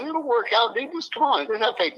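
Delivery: high-pitched urging, quoted; retold coaxing. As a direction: coax a doubtful friend, pitched well up. Retold reassurance during an argument.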